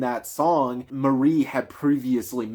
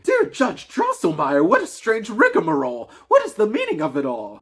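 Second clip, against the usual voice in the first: higher, lightly voiced stern mother; grave mother; 10 semitones higher, 3 dB louder than usual. The mother questions Drosselmeyer, a hint of gravity. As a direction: ask it with a touch of reproach. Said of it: "said very gravely".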